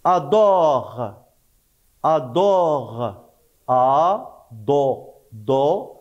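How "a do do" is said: "A, do" split into separate syllables. Shown as a demonstration. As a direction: break "adore" into "A", "do". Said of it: "on entend O". (découper "A, do" en syllabes)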